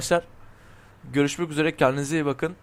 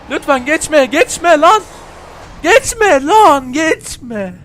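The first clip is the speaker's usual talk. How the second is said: very high, loud pleading yell; desperate shout; 10 semitones higher, 10 dB louder, much slower than usual. Real, not acted. Gamer screaming at the rival car not to overtake.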